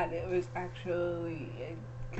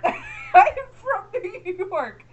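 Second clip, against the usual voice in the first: slow, higher, laughing New York "from"; New York accent; 11 semitones higher, 9 dB louder, much slower than usual. Shown as a demonstration. Wife showing off her New York accent while laughing. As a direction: lean into a Long Island accent, laughing. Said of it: "the only time we use the R".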